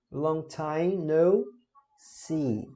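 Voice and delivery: slow, careful teacher model; slow model phrase. Shown as a demonstration. Teacher repeating the phrase slowly for students. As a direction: draw each word out clearly. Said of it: "Expressões para despedidas".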